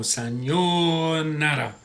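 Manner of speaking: "sayonara" slowly, lingering on the YO; held O. Shown as a demonstration. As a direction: hold that O in "sayonara" before the final NARA. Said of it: "you hold that O".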